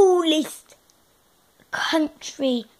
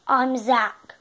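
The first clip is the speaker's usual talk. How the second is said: ordinary talk, nothing marked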